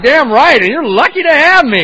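high, loud and laughing, full of swagger; cocky laugh. Retold or imitated. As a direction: crow it like you own the place. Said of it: "and I'm like".

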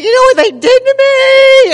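very high, very loud complaint; outraged wail. Retold or imitated. Calling a friend in California, hysterical outrage. Acts out hysterical phone call.

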